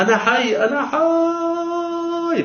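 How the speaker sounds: a high stretched scream, slow on the last words; screaming at the top of his voice. Retold or imitated. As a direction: scream from the grave that you are alive. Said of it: "بأعلى صوتي". (صرخة عالية ممدودة بأعلى صوته من داخل القبر)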